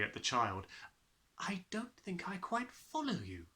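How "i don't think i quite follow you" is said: whispered and careful; puzzled whisper. A narrator's reading. Miss Honey whispering her puzzlement to Matilda. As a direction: hush it, wary and puzzled. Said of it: "she said".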